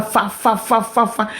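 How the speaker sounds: repeated "fa" ripping sounds; imitated tearing noise. Showing irons tearing clothes while running, imitated ripping noise. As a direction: mimic cloth ripping, beat by beat. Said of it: "déchirent ton habit comme ça".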